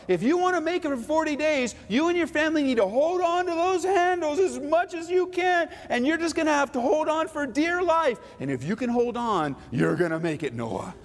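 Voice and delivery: high, hammed-up absurd command; mock voice of God. Preacher acting a silly God telling Noah, high mock voice. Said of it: "God doesn't come to Noah and say".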